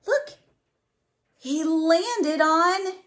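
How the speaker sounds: high, thrilled call to look; excited look. Acted. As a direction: point it out with delight. Pointing out the picture.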